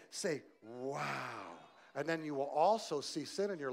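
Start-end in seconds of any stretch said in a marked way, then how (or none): 0.6-1.6 awed. Shown as a demonstration. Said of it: "Say it".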